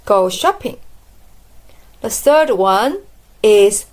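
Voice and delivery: usual speaking voice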